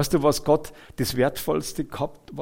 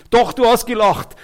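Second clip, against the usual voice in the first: higher, louder retort, acting out God; emphatic contradiction; 8 semitones higher, 7 dB louder than usual. Preacher voices God answering Sarah's denial, emphatic contradiction. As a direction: insist firmly, pitched up and loud.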